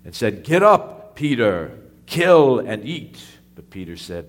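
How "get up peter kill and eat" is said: loud divine command, slow at the end. Acted. Voice from heaven commands Peter, loud and commanding. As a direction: boom it out like a voice from heaven. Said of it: "And a voice came to him and said".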